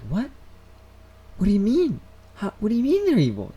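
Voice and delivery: put-on high-pitched, incredulous kid reaction; alarmed disbelief. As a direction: act out a child's startled questioning. Acting shocked younger self questioning sister.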